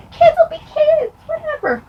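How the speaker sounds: very high, sing-song mock voice; mock dismissive. Waving away the sibling fight, mock dismissive. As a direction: toss it off in a squeaky falsetto.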